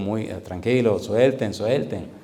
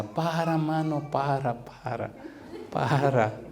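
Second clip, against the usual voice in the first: lightly voiced pleading church sister, pitched up at first; imitated Portuguese woman; 2 semitones higher, 4 dB quieter, much slower than usual. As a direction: mimic a foreign woman asking you to stop. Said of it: "había una hermana portuguesa que me decía".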